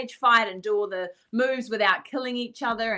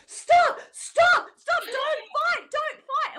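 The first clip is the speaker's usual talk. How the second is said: alarmed shout; much slower than usual. Retold or imitated. School director bursting in on a stage fight, shouting in alarm. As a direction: yell like a shocked headmaster breaking it up. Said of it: "burst in and went".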